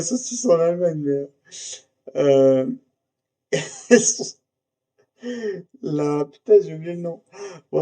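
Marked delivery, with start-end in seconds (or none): 3.5-4.3 laugh. Real, not acted. Laughs mid-sentence.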